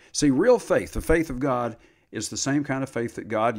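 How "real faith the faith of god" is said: usual speaking voice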